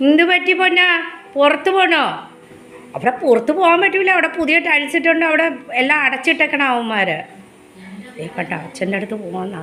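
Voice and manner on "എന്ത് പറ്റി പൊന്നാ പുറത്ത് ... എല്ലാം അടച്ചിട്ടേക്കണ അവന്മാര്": pet talk. Real, not acted. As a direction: owner cooing to her dog that wants to go out. Cooing to the dog.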